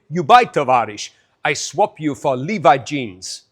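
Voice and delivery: mock Russian trader's accent; slow, put-on Russian accent. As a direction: offer a swap in a hammy Russian accent. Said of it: "That was my Russian accent".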